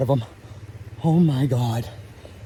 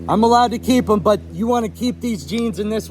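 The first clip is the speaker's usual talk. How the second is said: real thrill bursting out; excited; 9 semitones higher than usual. Real, not acted. Thrilled about keeping the fish.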